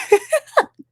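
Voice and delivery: high, loud giggle; real high cackling laugh. Real, not acted. Laughing at her own joke.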